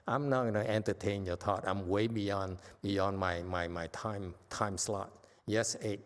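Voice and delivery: ordinary talk, nothing marked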